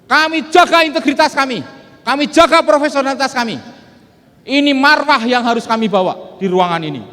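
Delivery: fiery motivational speech; rousing oration. Acted. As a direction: proclaim it to the hall like a vow. Opening oration, loud and raised.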